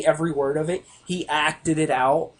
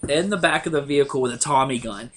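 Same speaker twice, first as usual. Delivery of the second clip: normal talking voice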